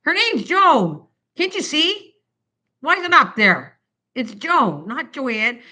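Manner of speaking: high, loud insistent correction; mock scolding about the name; retold group correction. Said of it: "everyone is giving me heck".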